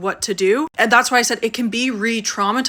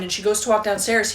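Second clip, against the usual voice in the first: normal talking voice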